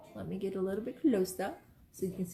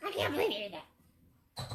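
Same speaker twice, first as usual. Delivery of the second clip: put-on mom voice for kids; 8 semitones higher than usual. Shown as a demonstration. Mother calling her kids over, scolding in high voice. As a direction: scold a child who just misbehaved. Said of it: "the voice for your kids".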